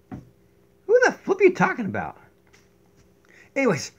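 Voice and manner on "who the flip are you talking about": high, indignant squeaky voice. Pretend. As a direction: butt in squeakily. High voice interrupts.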